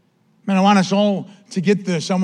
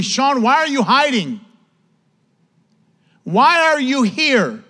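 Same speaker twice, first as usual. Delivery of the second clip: lightly acted rebuke, pitched up; imagined divine rebuke; 6 semitones higher, 3 dB louder, slower than usual. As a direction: question him as the Lord would, drawn out at the end.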